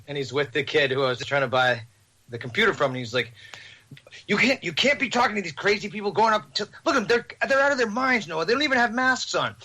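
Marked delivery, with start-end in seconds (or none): 4.3-9.6 high, agitated mock ranting; imitated irate man. Irate gentleman warning Noah about crazy people, imitated outrage. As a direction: imitate an outraged bystander's rant. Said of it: "irate gentleman".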